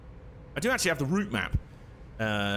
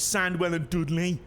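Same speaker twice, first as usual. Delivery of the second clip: higher-pitched Brummie place name; Brummie accent; 5 semitones higher, slower than usual. Shown as a demonstration. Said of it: "uh, Brummie accent".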